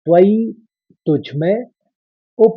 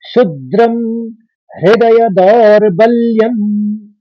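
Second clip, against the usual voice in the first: slow, higher, fuller chant; slow shloka recitation; 4 semitones higher, 3 dB louder, slower than usual. Shown as a demonstration. Teacher demonstrating the third line, slow chant. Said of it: "इस तरह से पढ़ेंगे तीसरे चरण को".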